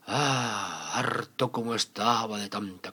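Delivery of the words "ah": usual speaking voice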